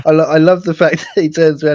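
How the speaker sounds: giggling, a bit fast and high; amused chuckle through the line; laughing through words. Real, not acted. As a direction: laugh while telling it.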